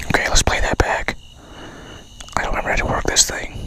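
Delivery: hushed nervous whisper. Real, not acted. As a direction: whisper so the ghosts won't hear. Fumbling recorder in dark.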